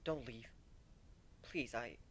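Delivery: desperate hushed begging; whispered plea. Acted. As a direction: beg in a broken whisper. Begging friend not to leave.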